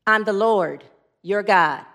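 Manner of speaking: a hint of a low, solemn God; booming voice. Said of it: "It's a booming voice".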